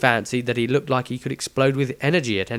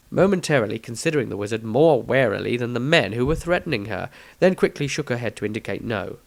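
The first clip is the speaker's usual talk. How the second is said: ordinary delivery, nothing marked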